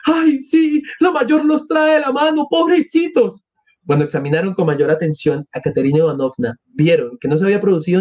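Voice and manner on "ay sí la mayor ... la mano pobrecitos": put-on high-pitched woman's voice fretting over children; acted crowd woman; anxious woman onlooker. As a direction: exclaim high with pity and concern.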